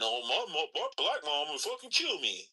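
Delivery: lightly put-on, high-pitched quote; mock gatekeeper. Man imitating someone afraid of mom, lightly mocking. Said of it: "you're gatekeeping the idea".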